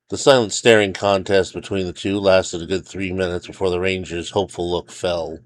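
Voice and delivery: usual speaking voice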